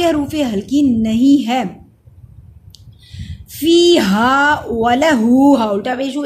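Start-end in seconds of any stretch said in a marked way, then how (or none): 3.5-5.5 फीहा वलहू recited very slowly and loudly; measured tajweed chant with long vowels; lengthened vowels. Shown as a demonstration. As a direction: stretch fee, haa and the final हू. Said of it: "एक अलिफ के बराबर लंबा होगा".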